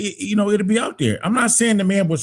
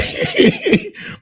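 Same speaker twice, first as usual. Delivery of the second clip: burst of laughter. Real, not acted. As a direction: laugh at the joke. Laughs at own joke.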